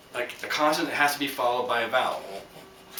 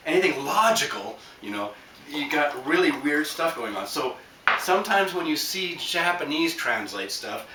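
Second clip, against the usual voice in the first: normal talking voice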